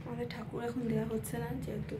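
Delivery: hushed voice so no one wakes; speaking softly. Real, not acted. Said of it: "তাই জন্য আস্তে কথা বলছি".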